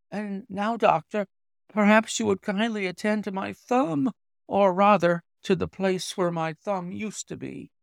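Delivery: a hint of a pale young patient; lightly voiced young engineer. Visitor asking the doctor to treat his thumb, lightly voiced.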